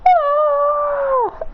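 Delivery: high gasp of shock; fainting gasp. Acted. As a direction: gasp and keel over. Chi-Chi faints at the money.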